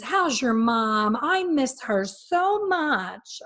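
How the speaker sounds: high, drawn-out fawning imitation; mock gushing client. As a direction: mimic someone gushing with exaggerated fondness. Voices client gushing, mocking insincerity.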